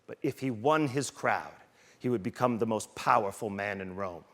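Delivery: low, quiet intense impression of Ray Lewis. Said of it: "He goes".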